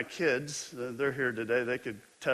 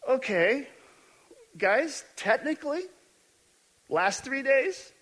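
wry amused Jesus; 8 semitones higher than usual. Retold or imitated. Jesus answers Cleophas, wry and amused. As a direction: let the joke land with a knowing grin. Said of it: "he's thinking".

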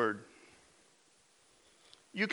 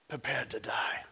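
soft, breathy whispered menace; whispered threat; 5 semitones lower, 8 dB quieter than usual. Acted. Preacher telling believers to face the enemy, whispered. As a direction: whisper it like a secret vow. Preacher repeats movie line.

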